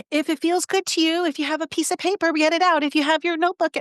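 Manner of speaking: high, tentative classroom voice; high, gentle teacher giving careful instructions; hedging teacher voice. Retold or imitated. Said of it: "that's how I was as a teacher".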